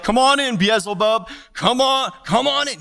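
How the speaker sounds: high, loud cheery welcome; mock welcome. Preacher mocks welcoming Lucifer, cheery. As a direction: invite him in with mock cheer. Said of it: "open up the door and be like".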